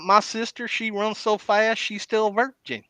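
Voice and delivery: put-on joke voice. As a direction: ham up the punchline, pitched high.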